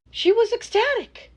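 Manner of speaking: high, loud insistence; insistent high burst. Acted. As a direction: pitch it up to sell the lie. Insists bride was happy.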